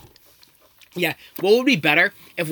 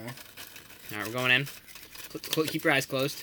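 hushed; 3 semitones lower, 12 dB quieter, much slower than usual. Real, not acted. Hushed while feeding blindfolded tasters.

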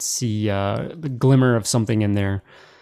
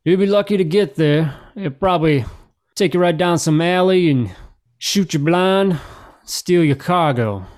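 higher, mildly raised officer voice; pitched-up in-character callback; officer calling back; 7 semitones higher, 4 dB louder than usual. Acted. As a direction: call back a warning to travellers. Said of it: "One of them kinda calls back".

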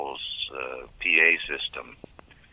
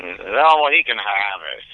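put-on high, grumbling old star; Hans Conried voice; 6 semitones higher, 5 dB louder than usual.

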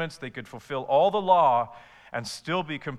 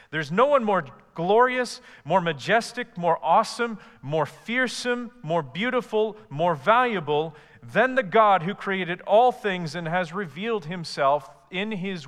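rising fervent praise; 5 semitones higher, 5 dB louder than usual. Acted. Preacher building praise of God.

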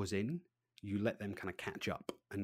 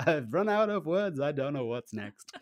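laughing panic; 5 semitones higher, 6 dB louder than usual. Retold or imitated. Mock panic while laughing.

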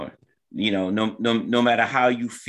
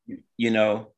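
ordinary talk, nothing marked

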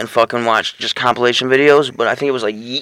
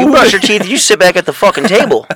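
stern parent; 6 semitones higher than usual. Acted. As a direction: scold the kid at the table. Acting a strict parent.

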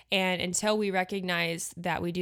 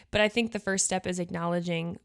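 ordinary talk

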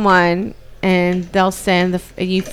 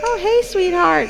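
firemen to little daughter; 9 semitones higher, 3 dB quieter than usual. Retold or imitated. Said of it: "they were like".

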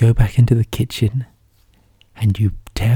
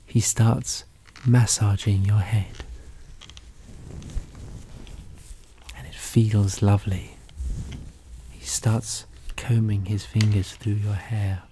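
soft soothing narration; 8 dB quieter than usual. A narrator's reading. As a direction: drop the voice, gentle and hushed. Soothing head massage described.

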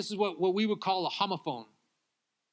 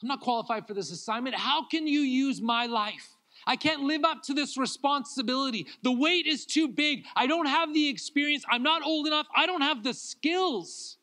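higher, louder anxious pleading; pleading protest; 8 semitones higher, 3 dB louder than usual. Retold or imitated. Jeremiah protesting his calling to God, anxious pleading. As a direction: protest you can't carry this weight. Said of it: "He's saying".

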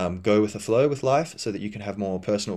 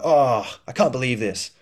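louder, higher mock disbelief; mock complaining; 4 semitones higher, 4 dB louder than usual. Imitating a complainer for listeners, mock disbelief. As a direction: play the indignant complainer. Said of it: "You're, like, complaining about things".

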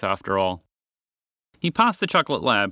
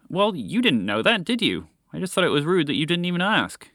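higher, lightly indignant dog character; lightly voiced huffy lab; 7 semitones higher than usual. A narrator's reading. Lab defending itself to the moose, lightly indignant. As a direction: justify yourself with mild indignation.

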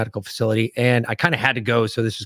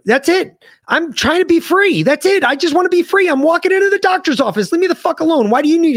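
high mock rant. Mocking rant about doctor's questions, pitched way up. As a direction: voice pitched way up, shrill complaint.

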